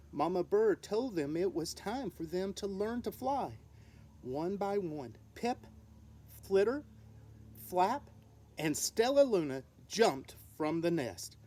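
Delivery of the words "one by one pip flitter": ordinary delivery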